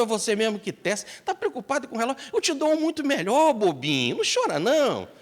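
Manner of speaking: high, soothing voice coaxing a friend to stop crying; comforting Lord. Acted. The Lord consoles Gandhi about the stolen watch, high and coaxing.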